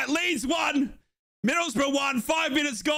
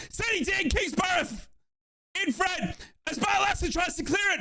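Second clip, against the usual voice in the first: excited, raised commentator voice; live play-by-play; 3 semitones higher than usual. Acted. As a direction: call it live and fast.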